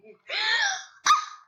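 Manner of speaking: high rising gasp; surprised gasp. Real, not acted. Seeing the score, high surprised gasp. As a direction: gasp in shock.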